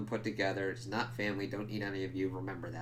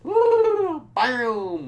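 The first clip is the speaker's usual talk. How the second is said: high, loud, goofy whooping; hammed-up celebration voice; mocking whoop; 14 semitones higher, 13 dB louder, much slower than usual. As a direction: cheer it like a clueless idiot. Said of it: "Instead of being like".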